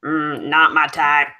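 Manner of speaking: slow hobo drawl; sex hobo voice. Acted. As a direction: play a slow, unimpressed hobo. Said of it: "why are you stealing my sex hobo voice".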